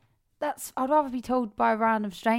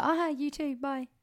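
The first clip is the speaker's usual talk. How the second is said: happy-mood reply; 6 semitones higher, 5 dB quieter than usual. Retold or imitated. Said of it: "like, a happy, funny mood".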